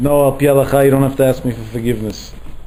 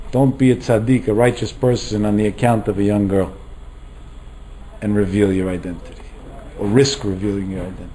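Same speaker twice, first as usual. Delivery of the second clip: lower, softer voice from heaven; divine voice; 5 semitones lower, 5 dB quieter than usual. Acted. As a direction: speak low and hushed like a heavenly command. Heavenly voice speaking to Taz.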